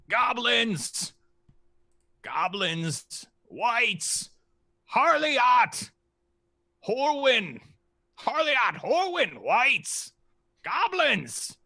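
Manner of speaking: high, squawking dragon calling out names; a greedy dragon blurting out names one by one; excited dragon voice. Acted. Dragon listing foes after taking gold, high excited calls.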